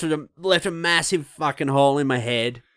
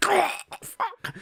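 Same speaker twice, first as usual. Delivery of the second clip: high sudden yelp of pain; sharp cry at a dentist's jab; 4 dB quieter than usual. Retold or imitated. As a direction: yelp like the needle hit a nerve.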